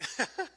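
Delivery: choked laugh through tears; tearful laugh. Real, not acted. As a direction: laugh while fighting tears. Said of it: "I'm up here crying".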